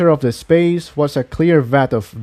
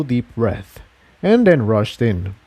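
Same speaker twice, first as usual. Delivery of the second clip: ordinary delivery, nothing marked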